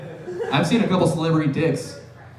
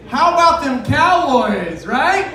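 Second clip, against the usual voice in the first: cheesy cheer; 6 semitones higher, 6 dB louder, slower than usual. Pretend. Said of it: "cheesy sports cheer line".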